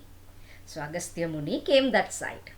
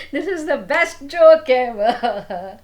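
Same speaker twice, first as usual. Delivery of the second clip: loud high laughter, words spoken through it, slow; laughing loudly; 9 semitones higher, 10 dB louder, slower than usual. Acted. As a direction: laugh hard at the joke. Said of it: "Agastya laughed".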